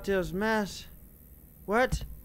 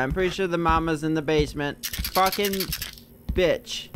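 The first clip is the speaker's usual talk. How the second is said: usual speaking voice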